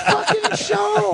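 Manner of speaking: laughing drawn-out high yell; shouting. Real, not acted. Pumping up tired crew.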